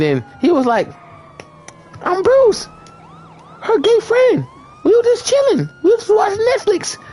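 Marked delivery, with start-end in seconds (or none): 2.0-7.0 Bruce explaining. Retold or imitated. Bruce introduces himself to the angry boyfriend, high light voice. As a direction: chirp an innocent explanation.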